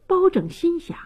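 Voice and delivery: usual speaking voice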